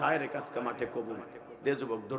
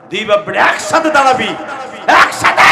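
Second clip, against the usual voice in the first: higher, much louder shout of the order; shouted command; 8 semitones higher, 17 dB louder than usual. Real, not acted. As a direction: yell it across the field. Repeats order, then shouts it.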